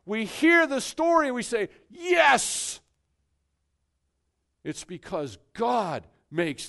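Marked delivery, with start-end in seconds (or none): 1.9-2.8 a high, ringing shout of yes; joyful shout of agreement. Acted. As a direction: cry out yes with full conviction. Repeat of the believer's answer.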